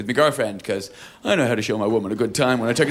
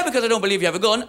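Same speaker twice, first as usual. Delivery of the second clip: usual speaking voice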